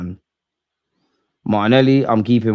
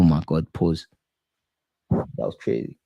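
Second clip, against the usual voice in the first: stunned whisper; 6 semitones lower, 6 dB quieter than usual. Real, not acted. Shocked by a violent head clash.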